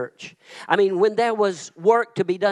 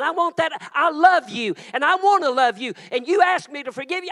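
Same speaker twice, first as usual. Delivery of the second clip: voicing God; 8 semitones higher than usual. Pastor speaking as God to the forgiven, shouting. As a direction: thunder it out as God. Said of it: "He says".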